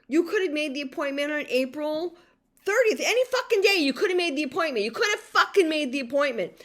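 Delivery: high, loud, exasperated mimicry of the agent; angry mocking retelling. Retelling the insurance agent's words, furious and mocking. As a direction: spit back the agent's words in disbelief.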